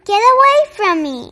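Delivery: loud, high, fast dismissal; angry shout. Acted. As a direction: drive him off with a yell. Rainbow Fish drives him away.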